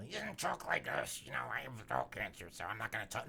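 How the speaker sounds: put-on metal singer's voice; mock rock-star speaking voice. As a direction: imitate a throat-strained rock frontman. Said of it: "do my [name] voice".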